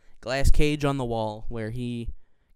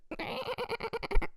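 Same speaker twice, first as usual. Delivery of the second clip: dolphin squeal; 8 dB quieter than usual. Retold or imitated. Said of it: "my dolphin impression".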